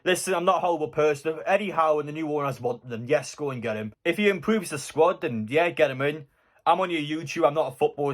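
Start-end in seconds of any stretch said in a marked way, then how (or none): none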